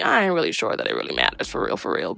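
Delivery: mock deep voice. Vlogger joking to viewers about pre-poo oil, in a put-on deep voice. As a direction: drop into a low, fast put-on bass voice.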